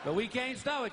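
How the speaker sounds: usual speaking voice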